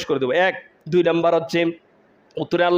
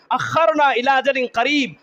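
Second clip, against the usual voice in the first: raised pitch, declaimed without melody; spoken preaching quote; 7 semitones higher than usual. Acted. Preacher repeats the plea to translate it, emphatic speech.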